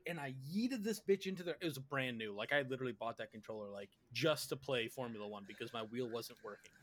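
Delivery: ordinary talk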